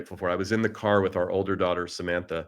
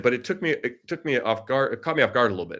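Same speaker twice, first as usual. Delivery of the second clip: ordinary delivery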